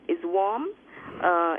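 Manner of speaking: usual speaking voice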